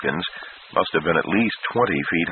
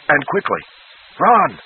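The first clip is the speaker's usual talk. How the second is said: urgent command; 7 semitones higher, 3 dB louder than usual. Acted. Peter ordering the flight.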